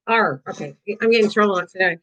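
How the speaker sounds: ordinary delivery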